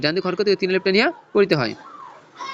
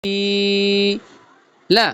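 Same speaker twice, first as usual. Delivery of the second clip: single syllables with a pause after each; much slower than usual. Shown as a demonstration. Teacher splitting the line for pupils, syllable by syllable. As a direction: break the verse into separate sounds. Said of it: "ভাঙ্গা ভাঙ্গা সুরে বলবো".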